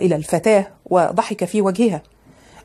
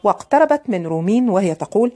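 ordinary delivery

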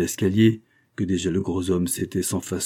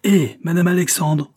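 louder, much higher repeat call; insistent shout; 10 semitones higher, 6 dB louder than usual. A narrator's reading. Man summoning the landlady a second time, shouting. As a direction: hail her harder down the stairwell. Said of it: "cria-t-il".

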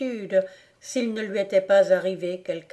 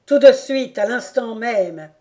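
much louder, higher frantic order; shouted; 3 semitones higher, 10 dB louder than usual. Acted. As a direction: bark it out in terror. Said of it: "s'écria le comte".

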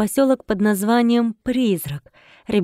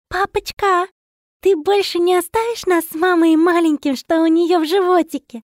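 put-on high child's voice asking earnestly; little girl voice; 10 semitones higher, 5 dB louder than usual. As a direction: speak as a bright little daughter. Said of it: "поинтересовалась моя не по годам смышлёная доченька".

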